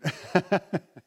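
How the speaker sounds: brief real laugh after a joke; a quick amused chuckle; short laugh. Real, not acted. Preacher laughs at his marriage joke, short laugh.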